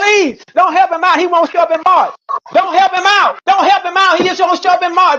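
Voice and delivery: high-pitched, insistent real plea; agitated pleading. Real, not acted. Debater begging the others not to help his opponent, agitated. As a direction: plead urgently, repeating it over everyone.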